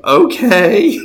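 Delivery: high, loud, drawn-out okay; hesitant agreement. Retold or imitated. Woman unsure about being prayed for, hesitant agreement. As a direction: imitate someone agreeing doubtfully, voice way up. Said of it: "she's kinda shaking her head".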